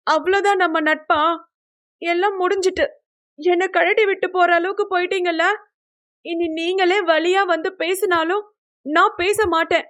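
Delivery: high-pitched wounded outburst; hurt, sulky anger. A narrator's reading. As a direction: vow it in a high, offended voice. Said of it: "கையை முறுக்கி பெட்டில் குத்தியவள்". (கோபமும் அழுகையும் கலந்த உயர்ந்த குரல்)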